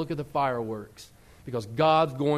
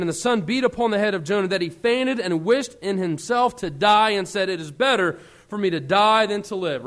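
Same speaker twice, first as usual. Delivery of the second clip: raised, declaiming Bible reading; scripture reading; 3 semitones higher, 6 dB louder than usual. Acted. Preacher reading Jonah's story, declaiming. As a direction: read the verse with fervor.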